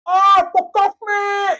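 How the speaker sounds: high, loud jeering shout; mocking yell. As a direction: bellow it like a rowdy local heckler. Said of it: "And you can hear him".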